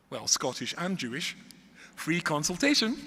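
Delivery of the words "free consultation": loud, raised punchline; delighted, voice lifting high and loud; gleeful opportunism. Acted. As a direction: pounce on the bargain with comic relish. Son spots a lucky chance.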